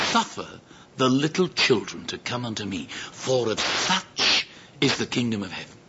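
tender solemnity. Acted. Jesus welcoming the little children, tender and solemn. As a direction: say it gently and gravely, with room between phrases.

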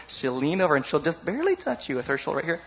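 ordinary talk, nothing marked

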